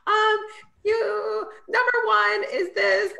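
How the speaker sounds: high, tense, nervous voice. Retold or imitated. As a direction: squeak it out, very nervous. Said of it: "I was so nervous".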